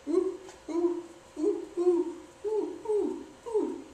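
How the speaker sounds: high, hooting ooh-ooh monkey calls; monkey hoots. Pretend. Showing off the monkey trick, high chimp hoots. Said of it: "The monkey trick looks like this".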